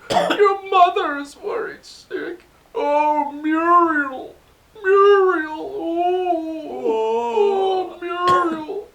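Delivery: high, loud, slow, wailing scold that breaks into sobs; a fretting parent ham who falls apart; hammed-up weeping mother crying out for Muriel; dramatic mother voice. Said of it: "I can do voices".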